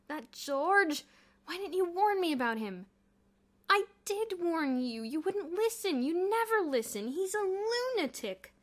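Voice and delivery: high, loud, slow exasperated rant; exasperated outburst. A narrator's reading. The boys bicker over George, exasperated. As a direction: vent it in exasperation.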